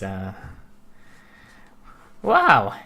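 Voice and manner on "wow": high mocking witch voice. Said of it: "the scorpion witch says".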